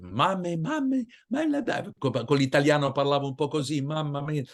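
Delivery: put-on high, excited little boy with a thick Italian accent; kid's Italian-accented chatter, fast and high; childish Italian accent. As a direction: call for mamma like a seven-year-old Italian boy. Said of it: "ma voix d'un petit garçon de sept ans avec un accent italien".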